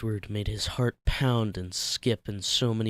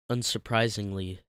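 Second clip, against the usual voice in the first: plain reading voice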